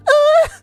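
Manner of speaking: high sudden "ah"; playful high-pitched outcry; mock startled cry. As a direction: yelp as if the crash hit. Imitates reaction as tower hits ground.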